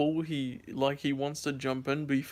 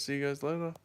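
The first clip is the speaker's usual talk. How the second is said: ordinary talk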